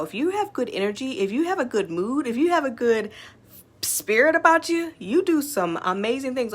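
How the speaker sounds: rising pep talk; building excitement. Real, not acted. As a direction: pump it up phrase by phrase. Building motivational list.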